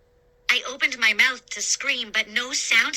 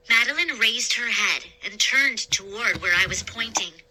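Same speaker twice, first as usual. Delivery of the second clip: ordinary delivery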